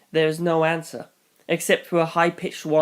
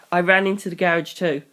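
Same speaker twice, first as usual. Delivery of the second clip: plain reading voice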